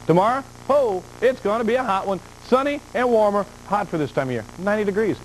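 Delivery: excited. Real, not acted. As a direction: pitch up, punchy and eager. Forecaster hyping a hot day.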